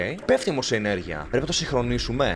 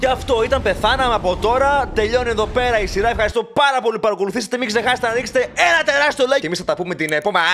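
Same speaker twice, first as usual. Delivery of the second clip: mock outro; 8 semitones higher, 4 dB louder than usual. Mock outro after apparent death.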